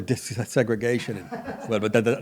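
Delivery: quiet giggling, stumbling words; laughing stammer. Real, not acted. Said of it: "now I'm stuttering".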